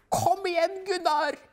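wife shouting at the TV for her skier; cheering shout. Acted. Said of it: "roper hun".

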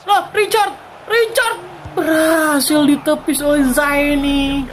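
rising excitement on a chance; excited call. Real, not acted. Chance near goal.

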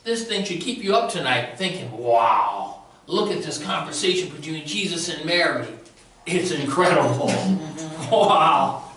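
amazed excitement. Real, not acted. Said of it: "This blew me away".

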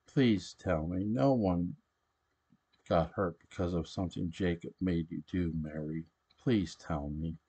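ordinary delivery

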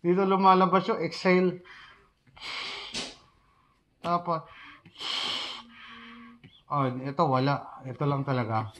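muffled behind full face mask; speaking through respirator voicemitter; through a gas mask. Real, not acted. Seen 1: Full-face gas mask worn over mouth through all labelled frames.

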